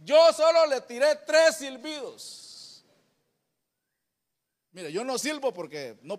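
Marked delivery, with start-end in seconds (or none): 0.0-2.8 slow, proud and louder brag; boastful father. Retold or imitated. Father bragging to his son how he won mom. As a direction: savour the boast slowly. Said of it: "Y cómo hiciste".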